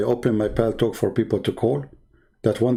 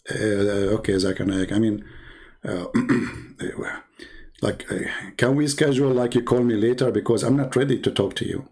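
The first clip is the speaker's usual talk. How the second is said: ordinary talk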